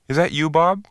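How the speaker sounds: hesitant, half-hoping he has the right man; doubtful question. Acted. Tall stranger tentatively asking the waiting man, doubtful. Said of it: "he asked doubtfully".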